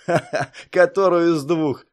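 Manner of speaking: laugh, then toss out the question; amused jeer through a door; mocking laugh. A narrator's reading. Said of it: "засмеялся за дверью Пастухов".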